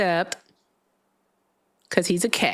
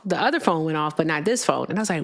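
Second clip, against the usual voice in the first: ordinary delivery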